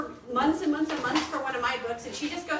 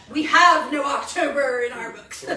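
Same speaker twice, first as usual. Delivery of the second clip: laughing it out, loud and amused; a loud laugh through the reply; 10 dB louder than usual. Retold or imitated. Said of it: "And I'm like".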